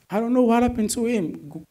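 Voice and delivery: high-pitched disbelief; high exasperated. Real, not acted. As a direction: marvel at it in disbelief.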